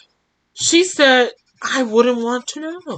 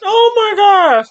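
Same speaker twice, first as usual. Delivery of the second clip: laughing; 14 semitones higher, much slower than usual. Real, not acted. Reader cracks up at plot twist.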